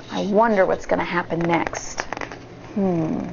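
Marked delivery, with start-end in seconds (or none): none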